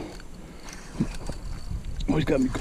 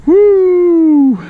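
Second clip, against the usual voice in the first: a high, loud joyful woo; triumphant whoop; 10 dB louder than usual. Real, not acted. Angler celebrating his seven pound bass with a loud high woo. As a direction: shout out the celebration.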